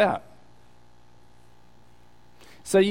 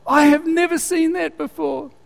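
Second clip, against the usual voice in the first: astonished; 11 semitones higher than usual. Retold or imitated. Lady's astonished retold reply.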